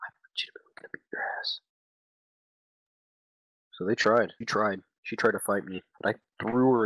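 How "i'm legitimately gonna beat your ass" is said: hissed threat in a whisper; whispered fury over the wrecked car; mock angry whisper. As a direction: threaten her in a fast whisper. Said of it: "She was like".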